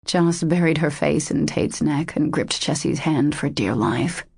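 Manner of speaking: usual speaking voice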